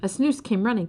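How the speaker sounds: ordinary delivery, nothing marked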